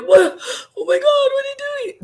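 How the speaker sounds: a hammed-up wail of disbelief at your teen; shocked parent. Parent reacting to a child who wants space, mock dismayed cry. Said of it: "You're like".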